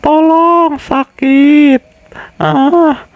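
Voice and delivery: a wounded victim's high-pitched plea; squeaky victim voice. Acted. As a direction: play the injured man squealing in pain. Corpse voiced crying for help.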